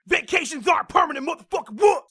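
high, put-on fighter voice; mock-combative, jumping up in pitch; Diaz brothers. Fighter answering a jab about his long vacation, hammed-up and combative. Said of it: "Why are the Diaz brothers there?".

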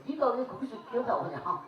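Jeolla accent with a blunt 기어나오냐 ending; Jeolla dialect version. Shown as a demonstration. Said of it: "전라도 버전으로".

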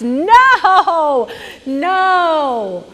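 high, loud laughing no; amused laughing protest. Retold or imitated. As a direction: laugh it off as you say no. Agent laughing at her.

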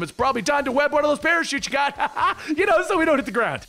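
high, hurried plea with a laugh; nervous laughing. Acted. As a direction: laugh awkwardly while hinting urgently. Wade laughs nervously while falling.

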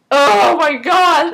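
slow, appalled outburst; disgusted exclamation. Real, not acted. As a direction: draw it out, grossed out. Disgusted reaction to gory scene.

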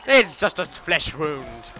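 imitated stubborn knight. Player quoting the Monty Python wounded knight, slow mock voice.

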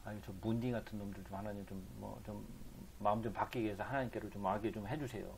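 soft, low mock prayer; imitated pleading prayer. Preacher imitating believers asking God to change stubborn people, softly pleading. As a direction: plead quietly, as if praying for others. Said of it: "우리가 그렇게 기도하고 있으면".

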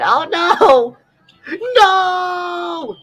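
high shouted no; alarmed shout. Real, not acted. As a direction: cry out in dismay. Card game setback, shouting.

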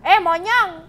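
high, loud startled cry; startled yelp. Real, not acted. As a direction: jump at the fright. Startled by something in game. (teriak kaget)

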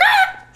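very high, loud instinctive shriek; reenacted yell. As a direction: yelp as if caught off guard. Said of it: "I just yelled".

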